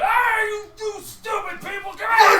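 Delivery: high, loud furious yelling; loud high angry shouting; raging shout. Acted. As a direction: scream at the crowd in a rage. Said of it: "you stomp and you".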